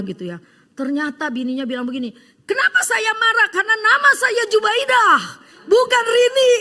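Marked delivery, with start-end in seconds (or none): 2.4-6.5 high, loud indignant outburst from the wife; furious wife. Acted. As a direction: shout it out as the wronged wife, much higher and louder. Wife angrily reveals the name.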